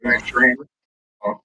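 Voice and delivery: muffled, like inside a can; muffled bass only. Real, not acted. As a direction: voice through a bad, muffled mic. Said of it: "You, you sound muffled".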